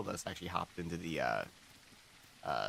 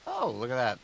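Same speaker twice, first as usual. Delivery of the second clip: surprised; 5 semitones higher, 8 dB louder than usual. Real, not acted. Spots a taco on the beach.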